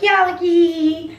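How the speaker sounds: high, slow, loud hype voice; hyped-up fake energy. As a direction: pump it up like a peppy vlogger. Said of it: "be more awake and be like".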